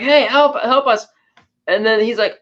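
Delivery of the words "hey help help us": raised, higher help call; mock call for help from the water. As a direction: wave down the boat, calling out. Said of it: "they're waving like".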